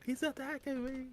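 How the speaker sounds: imitating her shrieking for help in a high voice; mock scream. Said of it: "still screaming".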